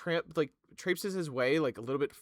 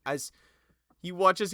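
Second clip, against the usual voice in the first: ordinary talk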